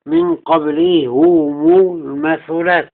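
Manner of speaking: قبلهم المثلات linked as qablihumu-l-mathulaat; slow recital, "u" vowels on both h and m of قبلهم; damma on haa and meem. Shown as a demonstration. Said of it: "بضم الهاء والميم".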